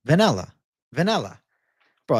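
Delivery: normal talking voice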